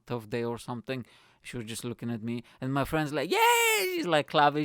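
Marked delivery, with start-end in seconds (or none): 3.3-4.1 very high, loud squeal; happy cheer. Retold or imitated. Friend clapping for his Spanish order, squealed mock cheer. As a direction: imitate a delighted friend cheering. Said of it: "She's so happy".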